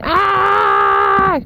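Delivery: high, sudden "Ah!"; startled yelp. Real, not acted. Owner yelps when a goat jumps on her.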